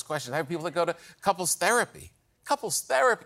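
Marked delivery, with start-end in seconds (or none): 2.4-3.2 higher-pitched, incredulous repeat; scornful disbelief. Acted. Comedian scoffing at people who go to therapy, incredulous. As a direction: throw the phrase back as if absurd.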